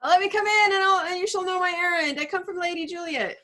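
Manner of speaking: put-on high, excited old nurse bursting in. Teacher reads the Nurse's part.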